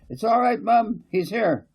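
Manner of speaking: high, loud call from upstairs; excited boy shouting down the stairs; calling downstairs. Acted. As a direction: call down to Mum from the landing. Kevin calls down the stairs.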